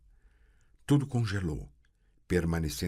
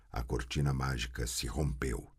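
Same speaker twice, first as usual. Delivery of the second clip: usual speaking voice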